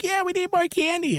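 put-on very high excited child; kid voice. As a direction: pipe it up like a greedy little kid. Kid wanting more candy.

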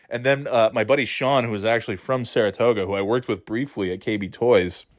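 ordinary delivery

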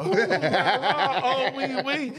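high, laughing mock French "ooh la la, wee wee"; hammed-up French accent through a laugh. Joking about Fyodor learning French, in mock French.

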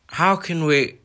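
high, loud, startled greeting that breaks off; uncle, cut off. Acted. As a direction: begin politely then choke on it.

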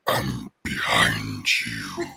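deep, slow creepy voice; menacing ghostly whisper-voice. Acted. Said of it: "I'll do some creepy voices".

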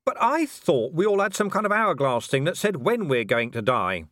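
high, insistent complaint; protesting Vimes. Acted. Vimes queries Death about hourglasses, high protest. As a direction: start high and argue, falling at the end.

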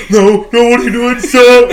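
high, loud mock panic; hammed-up frantic protest; imitating panicked friend. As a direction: yell it like you're freaking out. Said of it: "He was freaking out".

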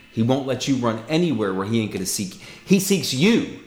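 rising, fervent preacher's exhortation; preaching. Acted. Preacher urging a lost listener, fervent and rising. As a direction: preach it, building to the call.

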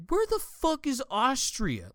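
mock the guy who never heard of Austria; baffled question. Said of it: "And he's just".

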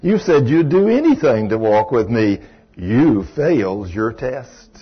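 slow, low solemn voice of God; a lightly voiced stern God, low and slow. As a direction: deliver a slow, heavy rebuke from above. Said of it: "I hear this wonderful voice".

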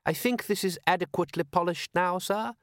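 lightly voiced proper butler; a hint of stiff, eager manservant; prim butler voice. Butler character voice.

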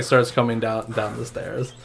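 ordinary talk

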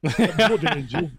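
Blood drawn out with a short northern oo vowel, laughing; slow Yorkshire blood. Shown as a demonstration. Repeating his Yorkshire Blood for friends, slow and laughing. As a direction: say Blood Angel slowly in broad Yorkshire, chuckling. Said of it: "It has to be Yorkshire".

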